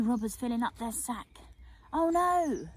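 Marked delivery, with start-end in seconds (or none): 1.9-2.7 higher, louder mock fright; a hammed-up startled cry; frightened gasp. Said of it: "I gasped in fright".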